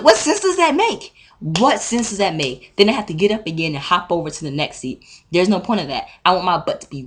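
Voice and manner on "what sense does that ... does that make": slow, loud, very high-pitched protest; outraged disbelief. Retold or imitated. Student rejecting brother's relayed offer, outraged.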